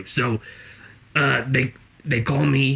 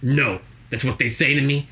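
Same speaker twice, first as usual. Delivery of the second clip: ordinary talk, nothing marked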